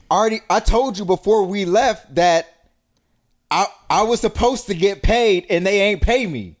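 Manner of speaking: high, loud acted protest; indignant defensive response. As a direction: protest high and loud, as the friend who won't pay. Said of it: "here's their response".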